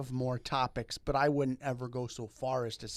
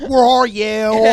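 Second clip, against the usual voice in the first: mock newscaster; 9 semitones higher, 15 dB louder, much slower than usual. Mock anchor follow-up.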